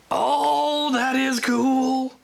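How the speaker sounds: high, slow delighted oh; thrilled and drawn out; excited. Real, not acted. Delighted at gift.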